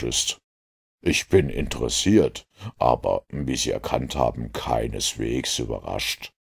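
low, soft, unruffled detective voice; composed Holmes. Acted. Holmes explains to the inspector why he isn't surprised, composed. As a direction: keep it low and coolly interested. Narrator plays Holmes, unsurprised.

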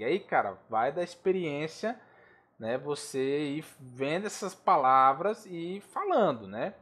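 ordinary delivery

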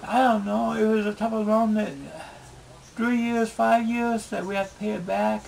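ordinary talk

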